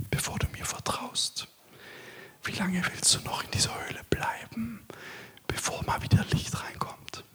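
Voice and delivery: intimate unvoiced whisper, close to the mic; hushed sermon whisper throughout; whispered preaching. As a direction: whisper it as if God is right beside you. Long whispered sermon section on God whispering.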